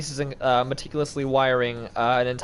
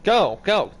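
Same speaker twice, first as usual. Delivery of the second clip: high, loud cheering on; excited urging; 4 semitones higher, 5 dB louder than usual. Real, not acted. Urging puppet through maze.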